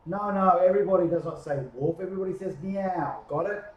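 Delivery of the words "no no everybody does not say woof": ordinary delivery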